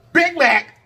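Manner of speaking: calling out. Acted. Calling out challenge names.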